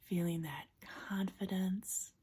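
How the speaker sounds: soft, unhurried relaxation-coach voice with long pauses; guided imagery. Acted. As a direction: gently guide them into a safe place, hushed and calm. Leads a guided imagery exercise.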